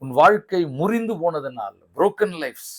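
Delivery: ordinary talk, nothing marked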